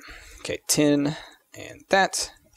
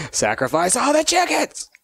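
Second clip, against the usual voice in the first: mock cult leader; 13 semitones higher than usual.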